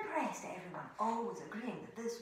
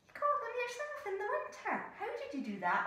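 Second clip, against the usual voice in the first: high eager questions; lightly voiced eager bear; curious bear; 11 semitones higher than usual. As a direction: ask eagerly. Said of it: "And the bear went".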